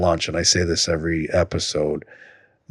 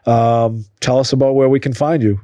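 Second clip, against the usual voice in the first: higher, projected sign-off voice; host outro; 7 semitones higher, 4 dB louder than usual. Real, not acted. Host asking guest to plug social media, projected outro. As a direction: lift it into an upbeat plug for the guest.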